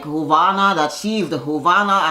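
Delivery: ordinary talk, nothing marked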